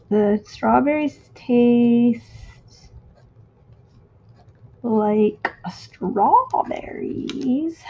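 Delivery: very slow, stretched words with a long pause; drawn out while writing. Real, not acted. Crafter lettering a film quote to herself, drawn out. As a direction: stretch each word as you write it down.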